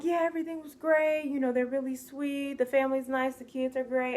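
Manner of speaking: high, chirpy imitation of a glowing report; upbeat praise. Acting out previous au pair's praise.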